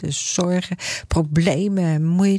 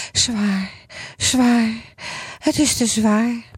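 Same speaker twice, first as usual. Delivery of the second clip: higher, complaining moan; groaning Grietje; 6 semitones higher, slower than usual. Acted. Grietje dragging her suitcase, moaning higher. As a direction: moan under the heavy load. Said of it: "Het enige wat ze nog kan zeggen is".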